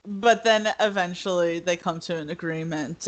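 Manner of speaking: usual speaking voice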